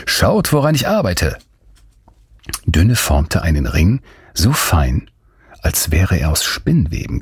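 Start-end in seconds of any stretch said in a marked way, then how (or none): 0.0-1.4 bright, high, delighted call; cheerful greeting. Acted. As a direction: show off your work with joy. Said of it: "Dünne begrüßte sie fröhlich".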